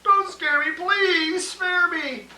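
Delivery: high, loud frightened pleading; panicked elephant. Acted.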